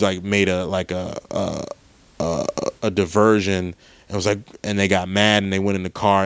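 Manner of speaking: usual speaking voice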